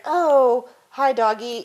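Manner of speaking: loud, high looming hello to a dog; Frankenstein greeting. Retold or imitated. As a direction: lurch over the dog with a big hi. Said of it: "I call it sort of Frankenstein".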